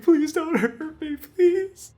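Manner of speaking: high, loud, slow frightened begging; desperate cracking pleading. Acted. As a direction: beg for your life. Frightened noble begs on floor.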